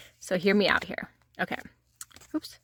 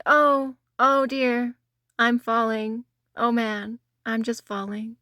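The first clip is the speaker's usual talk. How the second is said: usual speaking voice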